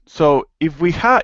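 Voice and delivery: usual speaking voice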